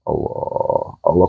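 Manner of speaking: soft breathy whisper of the name; whispered dhikr. Shown as a demonstration. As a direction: whisper it inward, like silent prayer. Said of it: "batin yang mengucap itu harus menembus".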